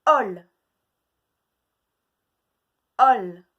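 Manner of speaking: loud, drawn-out syllable for guessing; called-out syllable. Shown as a demonstration. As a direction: call the clipped name out to the class. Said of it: "vous, de deviner lesquels c'est".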